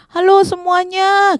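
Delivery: high, drawn-out call; berteriak memanggil. Acted. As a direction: holler slowly to the whole crowd. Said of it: "Bartimeus semakin lagi teriak". (seruan panjang bernada tinggi)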